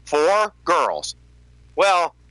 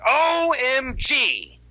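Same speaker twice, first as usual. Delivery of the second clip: exasperated retort; 5 semitones higher than usual. Retold or imitated. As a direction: scoff at how silly it is. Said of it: "I'm like".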